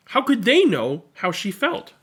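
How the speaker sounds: ordinary delivery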